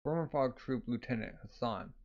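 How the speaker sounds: usual speaking voice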